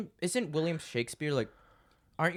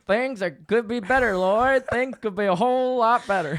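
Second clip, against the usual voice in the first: mock Southern gent; lightly voiced Southern accent; 7 semitones higher, 7 dB louder than usual. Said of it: "that was our best accents".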